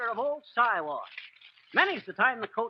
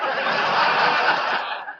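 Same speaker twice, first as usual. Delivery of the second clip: breathy chuckling laugh; wheezy laugh; 6 dB louder than usual. Real, not acted. Laughing at his own window-trimmer brag, breathy chuckle.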